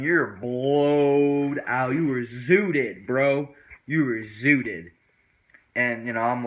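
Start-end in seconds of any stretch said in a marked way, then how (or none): 0.0-4.9 very slow stoned drawl; slow teasing. Retold or imitated. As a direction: drag it out, mocking. Friends mock him.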